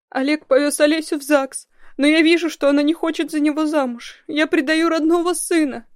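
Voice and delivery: high, loud tearful voice; crying. A narrator's reading. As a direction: plead through tears on the phone. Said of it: "плакала она в трубку".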